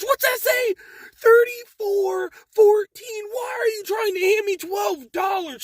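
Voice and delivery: high, loud, strained imitated shout; imitating an enraged customer, full volume; mock furious yell. Customer shouting at the manager over the refund amount, mock furious. Customer yells holding receipt up.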